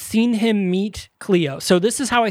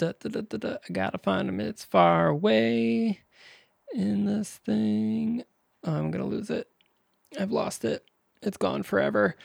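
quiet muttering; 4 semitones higher, 7 dB quieter than usual. Real, not acted. Muttering while searching, trailing off.

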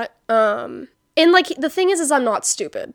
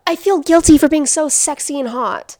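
mock apology; 3 semitones higher, 6 dB louder than usual. Sarcastic self-parody.

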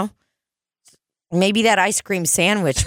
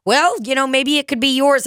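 playful couple voice; 8 semitones higher than usual. Retold or imitated. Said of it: "And they're like".

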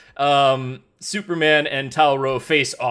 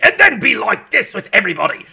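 raised Cockney burst; 5 semitones higher, 6 dB louder than usual. Acted. Podcaster imitating an actor's menacing turn, Cockney. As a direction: break into a mock Cockney accent. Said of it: "Of course, I went Cockney there".

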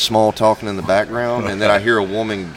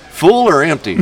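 laughing question; 6 semitones higher, 4 dB louder than usual. Retold or imitated. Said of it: "she goes".

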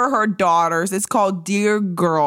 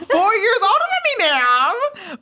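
shocked gasp; 11 semitones higher than usual. Real, not acted. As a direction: gasp then a high, fast shout. Shocked at friend's age.

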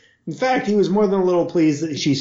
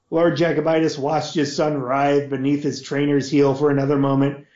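ordinary delivery

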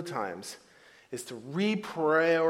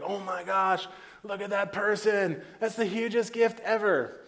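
higher, mock-impressed gushing; gushing awe; 6 semitones higher than usual. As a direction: fawn over the celebrity's gift. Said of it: "I was like".

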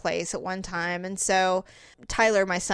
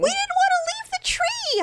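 put-on much higher whiny child voice; whiny kid excuse. Kids explaining why they stayed. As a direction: whine the excuse.

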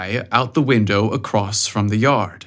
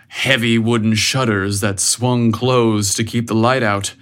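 plain reading voice

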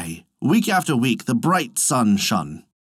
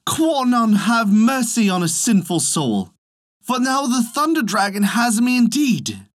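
high-pitched terrified plea, acted; frightened cry; 10 semitones higher than usual. A narrator's reading. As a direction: cry for mercy as a doomed peasant. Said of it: "cried the poor peasant man".

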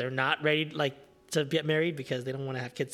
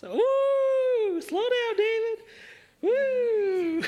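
mock cheering; much slower than usual. Watching the wedding video, cheering at David, high whooping. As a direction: whoop at the screen like a fan in the stands.

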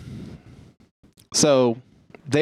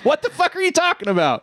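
incredulous; 14 semitones higher than usual. Retold or imitated. Told Kaplan doesn't exist.

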